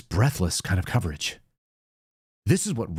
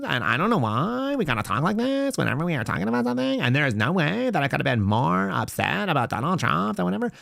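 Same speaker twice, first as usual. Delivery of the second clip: higher, sing-song uptalk on every phrase, mocking the congresswoman; mock Californian lilt with every phrase turned into a question; mocking Southern California uptalk; 5 semitones higher than usual. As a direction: lilt it up like a seventies valley girl. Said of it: "comes out like she's from, uh, Southern California from the nineteen seventies".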